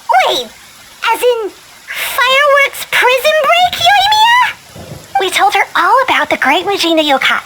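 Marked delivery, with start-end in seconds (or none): 0.1-5.2 slow, much higher, shouting in disbelief; startled realization. Acted. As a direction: blurt it out as it suddenly clicks. Paimon realizes who Yoimiya is.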